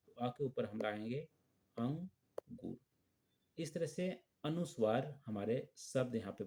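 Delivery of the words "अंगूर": अंगूर stretched slowly to show the nasal sound; slow, drawn out word. Shown as a demonstration. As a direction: draw अंगूर out slowly for the children. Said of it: "अ के बाद हमारा न आ रहा है".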